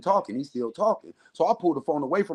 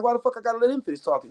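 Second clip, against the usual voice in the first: fast, high retold protest; pitched-up, hurried pushback; indignant objection; 8 semitones higher, faster than usual. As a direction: act out arguing back at a friend. Acts out objecting to advice.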